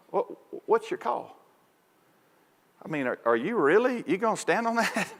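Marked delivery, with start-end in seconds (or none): 0.1-1.4 quiet question to the umpire, voice lifted; soft, mock-pleasant challenge; close-up aside. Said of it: "when you get close to him". 4.1-5.1 soft, needling question with raised pitch; hushed mock challenge; close-up aside. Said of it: "when you get close to him".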